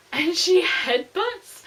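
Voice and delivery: slow, loud stressed build; excited. Real, not acted. Reader thrilled by the fight, slow and loud. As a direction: drag the words out in thrill.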